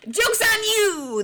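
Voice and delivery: high, slow, triumphant shout; gleeful reveal. Real, not acted. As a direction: crow it out, drawn out.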